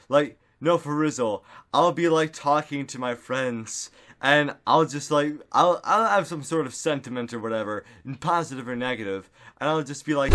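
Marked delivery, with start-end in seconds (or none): none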